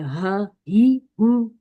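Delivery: very slow, heavy ह ही हु; heavy h demo. Shown as a demonstration. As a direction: draw out ह ही हु heavily from the throat. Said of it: "ये मोटा करके अदा होता है". (मोटा ह, धीरे धीरे)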